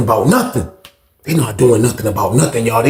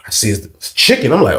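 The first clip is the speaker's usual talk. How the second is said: ordinary delivery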